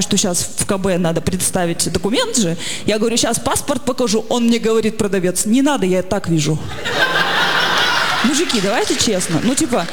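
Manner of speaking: usual speaking voice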